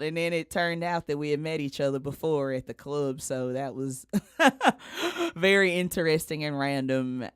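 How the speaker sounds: ordinary talk, nothing marked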